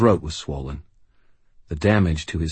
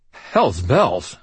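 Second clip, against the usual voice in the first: startled stammer; 3 semitones higher, 4 dB louder than usual. Acted. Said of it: "I stammered".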